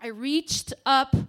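usual speaking voice

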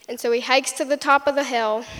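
ordinary talk, nothing marked

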